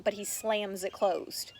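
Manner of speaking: ordinary delivery, nothing marked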